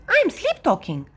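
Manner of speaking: put-on high little-girl excuse; cheeky little sister. A narrator's reading. Little sister answers brother at bedtime, cheeky. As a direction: protest it innocently.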